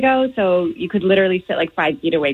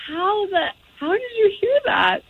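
high, astonished mock question; retold disbelief; 13 semitones higher than usual. Daughter retelling their reaction to Dad hearing, high-pitched disbelief. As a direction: act out stunned disbelief, pitched way up. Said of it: "And we're like".

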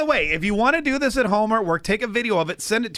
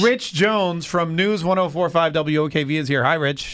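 ordinary talk

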